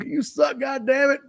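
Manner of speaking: high mock heckler voice; mock heckler jeering the wrestler; heckling fan. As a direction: yell at Watts like a fan in the crowd. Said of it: "this one guy".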